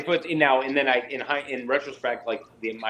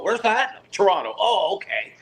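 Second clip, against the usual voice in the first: mock puzzled voice; 11 semitones higher, 5 dB louder than usual. People asking where Welland is.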